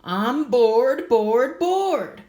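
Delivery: put-on high, loud singsong whine of a bored girl; bored kid whine. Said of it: "she heard Gertie say".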